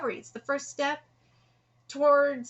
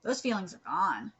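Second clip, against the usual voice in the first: ordinary talk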